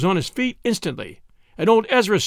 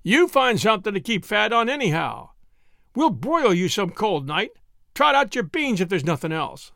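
lightly voiced soldier, mocking the cook, a touch higher; teasing soldier; 6 semitones higher than usual. A narrator's reading. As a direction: rib him like a bored soldier.

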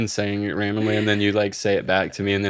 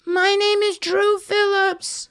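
high put-on voice; slower than usual. Intro in put-on voice.

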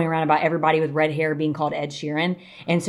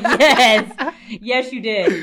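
higher, louder, laughing confirmation; laughing insistence; 4 semitones higher, 4 dB louder, much slower than usual. Retold or imitated. Wife telling husband he did, laughing insistence.

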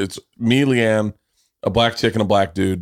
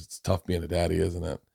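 soft, tender quote of a stranger; quoting Whitney softly; 5 semitones lower, 11 dB quieter than usual. Retold or imitated. Husband retelling Whitney comforting him, soft and tender. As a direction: say it gently, low and soft. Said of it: "she puts her arm around me and says".

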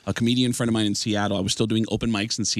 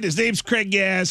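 "Craig Gass" in a higher Tom Arnold voice; Tom Arnold impression; 7 semitones higher, slower than usual. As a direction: Tom Arnold naming the impersonator. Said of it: "Tom Arnold ... says".

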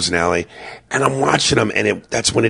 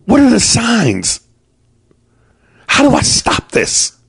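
mock panic; 12 semitones higher than usual. Real, not acted. Worried dad pleading.

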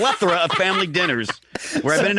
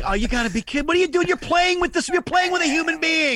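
higher, pleading disbelief; exasperated protest; 8 semitones higher than usual. Retold or imitated. Trapped guest protests to his friend, pitched up. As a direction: beg him to stop the game. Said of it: "I'm like".

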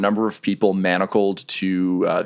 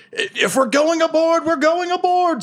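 much higher, slow and resolute captain; captain going aboard with the crew, a much higher resolute call; Captain Ayu. Acted. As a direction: commit to it, she'll follow you in. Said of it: "Captain Ayu is like".

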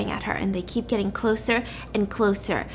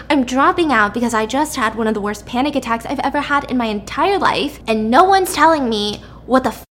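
freaking out; 3 semitones higher, 4 dB louder than usual. Acted. Panicked subject tells doctor she's dropping out, freaking out. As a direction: snap back loudly, fed up and overwhelmed. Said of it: "And so she's freaking out".

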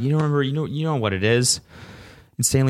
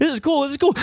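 high squeaky dog voice, much higher; French bulldog voice; faster than usual. Acted. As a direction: play the happy wheezing bulldog. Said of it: "my French bulldog impression".

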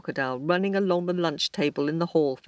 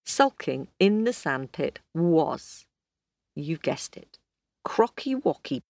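plain reading voice

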